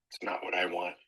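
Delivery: a deflated whisper of disappointment; whispered grumble. Acted. Host voicing a customer's let-down reaction, whispered. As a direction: mutter it under your breath, let down.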